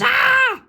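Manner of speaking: high, loud scream of shock; shrieking yell. A narrator's reading. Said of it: "And Candace yells".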